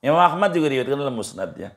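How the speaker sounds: ordinary delivery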